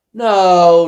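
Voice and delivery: sharp, loud exasperated no; correcting a confused friend, loud exasperated no. Retold or imitated. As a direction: shut the misunderstanding down hard. Correcting confused listener loudly.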